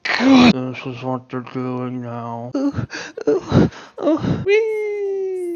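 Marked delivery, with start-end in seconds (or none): none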